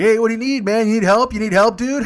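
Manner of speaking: high, loud jeering offer of help; loud mock concern from passing teens. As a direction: call out to him like a teasing kid. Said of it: "And then there's like".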